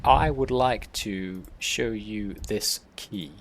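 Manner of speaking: halting, stretched words with long gaps; slow, deliberate address. Acted. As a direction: spell it out very slowly. Addressing Birdman, word by word.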